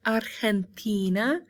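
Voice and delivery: "Argentina" slowly, with the G as a throaty H, ar-hen-TEE-na; Spanish Argentina. Shown as a demonstration. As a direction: model "Argentina" with a Spanish H-sounding G. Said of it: "the G makes that H sound".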